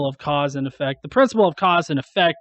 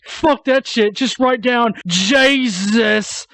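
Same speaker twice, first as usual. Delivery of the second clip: higher, dismissive mock voice; mocking a creationist; 4 semitones higher, slower than usual. Mocking creationists who skip the math, higher and dismissive. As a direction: scoff and wave away the work. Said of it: "And they're like".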